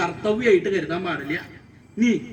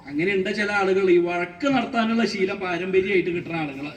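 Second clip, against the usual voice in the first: ordinary delivery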